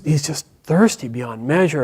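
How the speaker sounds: ordinary delivery, nothing marked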